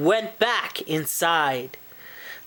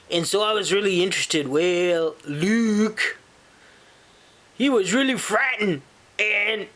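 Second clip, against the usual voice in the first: lower, casual talking moose; friendly moose character; 4 semitones lower than usual. Acted. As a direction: chat to listeners as a laid-back moose. Plays the moose introducing himself.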